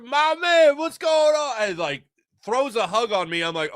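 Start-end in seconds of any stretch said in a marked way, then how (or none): 0.0-1.6 much higher, loud, overjoyed greeting; a thrilled stranger saying hello; excited fan. Retold or imitated. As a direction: greet him like a long-lost buddy.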